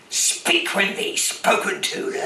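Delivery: put-on slow, gruff pirate growl at a boy. Shown as a demonstration. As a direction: bark it like an old sea dog. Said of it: "all the pirate voices, and, um, I love doing".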